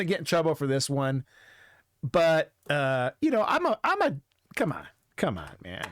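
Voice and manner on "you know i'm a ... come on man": usual speaking voice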